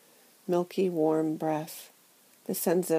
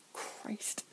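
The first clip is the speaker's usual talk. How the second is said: breathless whisper; 17 dB quieter than usual. Acted. Private despairing prayer.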